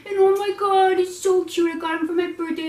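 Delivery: high, loud gushing over the puppy; showing off her new puppy, high and loud; gushing excitement. Real, not acted. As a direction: squeal it out, overwhelmed by cuteness.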